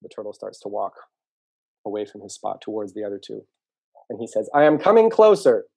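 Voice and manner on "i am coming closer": high, loud holler from afar; raised, carrying storybook shout; calling out loudly. Acted. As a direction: shout it over to someone in the distance. Turtle calls while still far off.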